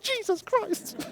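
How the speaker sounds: high, slow, mock-despairing groan of the words; hammed-up disbelief at the agent; exasperated Jesus Christ. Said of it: "I'm like".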